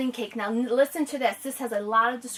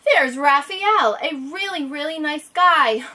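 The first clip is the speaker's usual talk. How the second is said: story reading; 5 semitones higher, 10 dB louder, slower than usual. Acted. Reads the picture book aloud.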